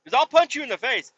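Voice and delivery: fast, high mock threat; voicing Russia threatening. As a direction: rattle off the dare as a cartoonish bully. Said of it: "He's like".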